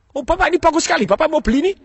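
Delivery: high-pitched eager sales pitch, hammed up; penjual bersemangat. Acting out eager seller demo. (suara tinggi penjual yang bersemangat)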